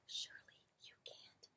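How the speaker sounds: tiny faint whisper; tiniest voice. A narrator's reading. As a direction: barely breathe the words. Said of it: "in the tiniest possible voice".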